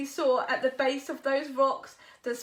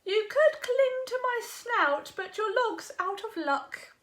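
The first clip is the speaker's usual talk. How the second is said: higher, a touch snooty hog voice; sniffy hog; 8 semitones higher, 4 dB louder than usual. Acted. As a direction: sniff it out dismissively. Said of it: "sniffed the hog".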